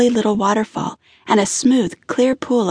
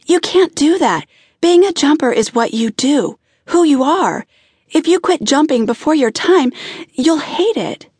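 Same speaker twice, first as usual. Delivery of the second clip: urgent protest; 6 semitones higher than usual. A narrator's reading. Woman begging her lover to keep jumping, urgent and raised. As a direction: plead with him not to quit.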